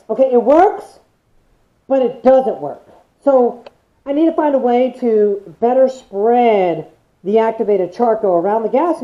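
muffled behind respirator; through a face mask. Real, not acted. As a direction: voice inside gas mask. Seen 3: Speaker wears dual-cartridge respirator over mouth and nose while talking.

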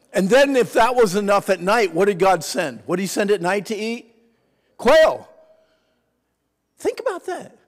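high, loud excited preaching. Acted. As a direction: build to a shout. Preaching at full force.

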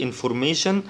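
انفورميشن, sh in the last syllable; English pronunciation. Shown as a demonstration. Teacher comparing languages, English ending on انفورميشن. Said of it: "بالإنجليزي بيتنطق شن".

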